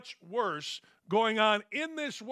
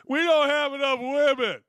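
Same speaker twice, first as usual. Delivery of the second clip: mocking whine, louder and higher; mock front office critic; 5 semitones higher, 7 dB louder than usual. Host mocking the critics of the front office. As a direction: voice the critics sarcastically. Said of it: "see, there's a shot".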